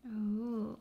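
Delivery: ordinary delivery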